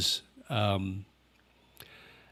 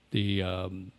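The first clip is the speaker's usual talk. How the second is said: ordinary talk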